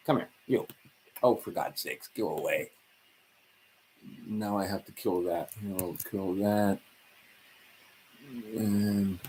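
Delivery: usual speaking voice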